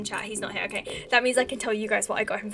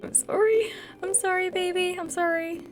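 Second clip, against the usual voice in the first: cooing apology; 7 semitones higher than usual. Real, not acted. Apologising to baby she scared awake, cooing.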